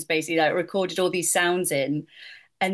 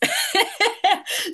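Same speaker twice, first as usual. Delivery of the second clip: high laugh; 14 semitones higher than usual. Real, not acted. Laughs about witch singing.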